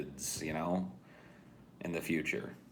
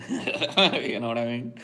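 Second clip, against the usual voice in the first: laughing; 3 semitones higher, 4 dB louder, slower than usual. Real, not acted. Laughing at his own idea.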